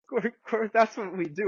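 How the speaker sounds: mock high indignant retort; imitating the doctor's high comeback. Doctor retorts, everyone laughs.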